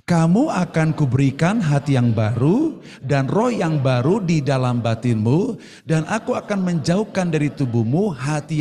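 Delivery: loud, slow scripture recitation; leading unison reading. Real, not acted. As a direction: read it out for a congregation to follow, loud and drawn out. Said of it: "Kita baca sama-sama. Satu, dua, tiga". (membaca ayat bersama dengan lantang)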